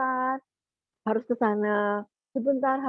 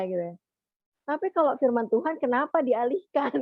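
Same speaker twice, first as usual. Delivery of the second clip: ordinary talk, nothing marked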